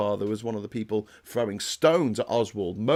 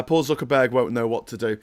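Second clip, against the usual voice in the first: usual speaking voice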